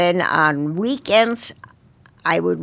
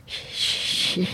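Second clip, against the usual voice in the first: choked up; 7 dB quieter than usual. Real, not acted. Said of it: "I get kind of emotional".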